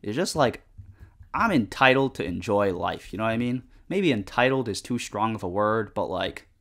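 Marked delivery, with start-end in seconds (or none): none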